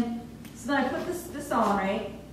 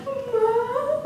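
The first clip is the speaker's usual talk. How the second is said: high, drawn-out wail; acted-out crying for mom; tearful wail; 13 semitones higher than usual. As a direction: whine it high like a sobbing child. Calling mom in panic, crying plan.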